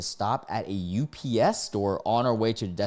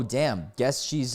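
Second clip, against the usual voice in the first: ordinary delivery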